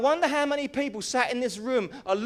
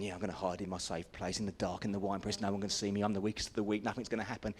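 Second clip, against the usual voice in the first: much lower and softer, fast; hushed, smug thought; 11 semitones lower, 7 dB quieter, faster than usual. Acted. Gideon hiding in the wine press, lulling himself in a hush. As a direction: murmur it from the hiding place.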